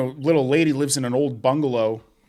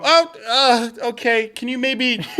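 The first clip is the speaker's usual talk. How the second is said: high, louder, hesitant put-on voice; awkward homeowner; 10 semitones higher, 3 dB louder, slower than usual. Homeowner watching ladder hit walls, high dismayed voice. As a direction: sound politely dismayed. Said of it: "they go".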